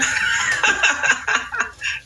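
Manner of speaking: a quick airy laugh, rising; high breathy laugh. Real, not acted. Laughing at her own courtroom story, light airy laugh.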